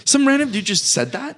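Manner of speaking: high, incredulous disbelief; can't believe a stranger said that; a friend reacting with pitch-jumping surprise; surprised. Real, not acted. Reacts to the odd story.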